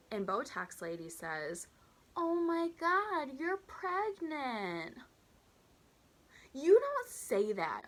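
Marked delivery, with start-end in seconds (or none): 2.2-5.0 high, long-drawn squeal of delight; gushing mock excitement. Botox Lady congratulates her waitress, gushing. 6.5-7.8 loud, high, drawn-out disbelief; appalled scolding. Real, not acted. Teller reacting to the pregnancy remark, appalled.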